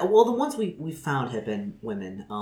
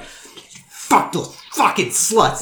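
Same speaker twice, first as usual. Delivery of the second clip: loud, high, slow-punched cursing; furious; 8 semitones higher, 7 dB louder, much slower than usual. Acted. Furious woman cursing those she blames, furious yell. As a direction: let the fury out on each drawn-out word.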